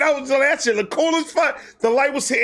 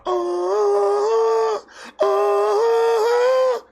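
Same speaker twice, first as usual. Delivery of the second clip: high wavering uh sound; high whine; 11 semitones higher than usual. Retold or imitated. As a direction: squeak like a window going down. Mimicking window noise.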